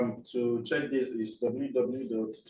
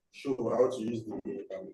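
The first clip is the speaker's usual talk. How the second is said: usual speaking voice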